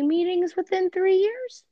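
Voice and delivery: high, loud disbelieving rise; incredulous. Real, not acted. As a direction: shoot up in disbelief. Incredulous at VR expectation.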